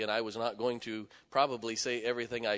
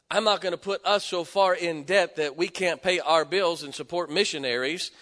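raised, forceful sermon voice; emphatic preaching; 7 semitones higher, 9 dB louder than usual. Real, not acted. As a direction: preach it with conviction, louder.

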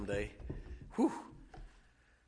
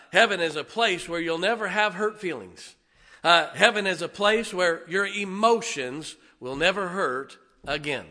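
loud, raised preaching voice; emphatic preaching; 5 semitones higher, 8 dB louder than usual. Real, not acted. Preacher telling congregation what God said settles it, emphatic. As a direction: drive each phrase home, loud.

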